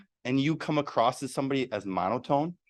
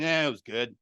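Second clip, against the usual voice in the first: unenthused reply. Acted. Sour colleague answering about the weekend, grudging and flat. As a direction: mumble it like you couldn't care less. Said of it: "sucked on a lemon".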